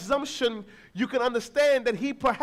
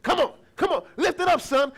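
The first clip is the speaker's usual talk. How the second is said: higher, louder encouraging cheer; 6 semitones higher, 6 dB louder than usual. Retold or imitated. Father cheering his toddler son up the stairs. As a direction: pump him up like a coach. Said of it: "his father saying".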